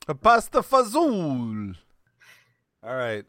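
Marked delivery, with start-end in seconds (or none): 0.1-1.8 hammed-up Italian-American; Italian-American accent; mock Italian-American. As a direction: say it like a deli guy from Brooklyn. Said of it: "like we're Italians in America".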